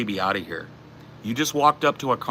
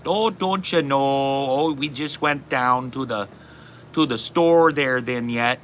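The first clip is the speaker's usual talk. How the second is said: drawn-out Midwestern accent impression; 5 semitones higher, slower than usual. Shown as a demonstration. Demonstrating regional dialects to chat, exaggerated Midwestern accent. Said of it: "the Midwestern accent".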